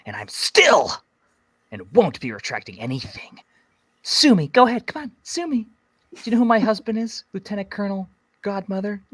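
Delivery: high, loud entitled Karen. Pretend. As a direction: taunt them like an entitled Karen. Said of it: "If you were Karen".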